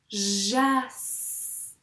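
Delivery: "Jas" stretched, long final hiss; sounded-out syllable. Shown as a demonstration. Teacher letting pupils hear each sound, drawn out. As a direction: draw out every sound in "Jas". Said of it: "qu'est-ce qu'on entend comme son".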